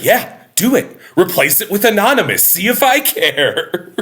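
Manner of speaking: [name] brushing off the curator's threat; mock defiant retort. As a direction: overdo the pretended indifference. [name]'s sour-grapes retort.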